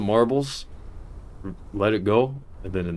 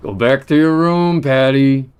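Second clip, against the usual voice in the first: loud high yell; shouting; 5 semitones higher, 9 dB louder than usual. Real, not acted. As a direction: shout the order again. Repeated shouted order.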